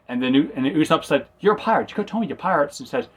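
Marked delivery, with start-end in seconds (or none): 1.4-2.8 shocked. Retold or imitated. Usopp shocked at pirates.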